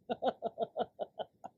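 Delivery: trailing run of chuckles; helpless laughter petering out; giggling fit. Real, not acted. Cracks up at own story.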